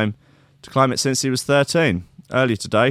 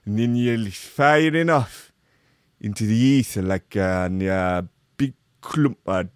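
slow put-on voice of a stuntman; rambling young climber; slower than usual. Host stretching the climber's quote into crude nonsense, put-on voice. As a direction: trail on slowly in character.

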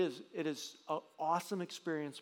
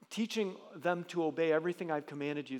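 normal talking voice

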